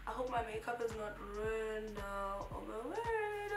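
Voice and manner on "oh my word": surprised. Real, not acted. Worried about makeup.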